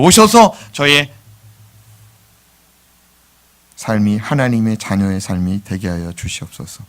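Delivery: reverent spoken prayer, slowing toward the end; solemn prayer voice. Retold or imitated. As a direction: pray it earnestly like a child's first prayer. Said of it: "같이 기도합니다".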